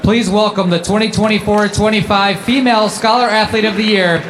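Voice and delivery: ordinary delivery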